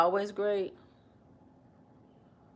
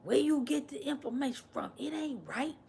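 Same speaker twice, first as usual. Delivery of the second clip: higher, whiny mock of a Trump supporter; 6 semitones higher, 3 dB quieter than usual. Streamer mocking Trump supporters' reply, in a higher mock voice. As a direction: parrot the doubting fan in a higher voice. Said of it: "Y'all be like".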